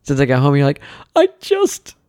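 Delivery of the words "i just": very high, slow sobbing whine; hammed-up tearful wife; mock crying. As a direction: wail it like a weepy pregnant wife. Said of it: "you're just crying".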